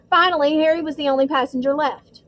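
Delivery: ordinary delivery, nothing marked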